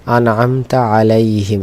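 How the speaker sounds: measured Quranic reading with long vowels; slow recitation. Shown as a demonstration. Teacher reads the verse to find lam, reciting slowly. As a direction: draw out the Arabic syllables.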